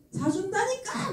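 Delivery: high, drawn-out exasperated mock protest; mock quarrel. Customer re-enacts a row with mom, mock exasperated. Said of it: "엄마랑 막 대판 싸우고".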